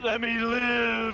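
loud, high, slow pleading. Real, not acted. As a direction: beg drawn out and loud. Pleading while being caught.

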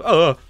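high, loud startled cry; sudden yelp. Real, not acted. Player caught mid-song in the game, sudden yelp. Cries out during play.

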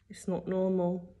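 soft, sighed-out resignation; weary mum giving up quietly; heavy sigh. A narrator's reading. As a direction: sigh the words, dropping the volume. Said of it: "she sighed heavily".

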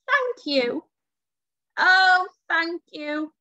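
high, crowing storybook thanks; grateful crowing. A narrator's reading. Witch thanking her rescuers, gratefully crowing. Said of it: "the grateful witch crowed".

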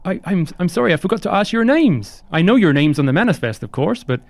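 high-pitched, apologetic crew member; chirpy high host voice; eager, polite ship hand Onion. Acted. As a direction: play a courteous, high-voiced deckhand, lilting upward.

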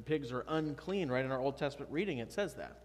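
normal talking voice

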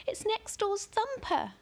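put-on very high, slow horrified girl; girl aghast, pitched very high and slow; shocked girl. A narrator's reading. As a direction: draw out the horror, very high. Ellie in shock.

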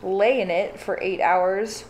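normal talking voice

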